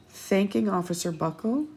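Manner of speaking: plain reading voice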